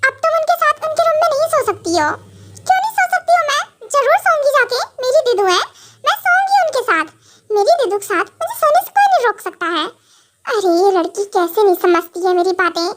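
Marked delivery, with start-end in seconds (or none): 2.6-9.9 put-on high, quick, stubborn girlish voice; petulant little-girl protest; defiant childish wife. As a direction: insist like a sulky kid who won't budge. Wife defiant about sleeping with sister.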